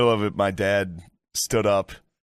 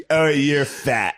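mock drunk insult; 6 semitones higher, much slower than usual. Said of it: "getting hit with just".

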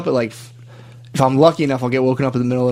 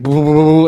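louder vocal heartbeat noise; imitated racing heartbeat; 2 semitones higher, 5 dB louder than usual. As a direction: make a rapid pounding-heart sound effect. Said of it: "My heart was like".

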